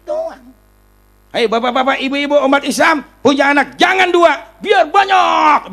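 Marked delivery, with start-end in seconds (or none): 1.3-5.7 high, loud cry with a drawn-out finish; re-enacted rally shout. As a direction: shout it out to a big crowd. Re-enacts his fiery past speech.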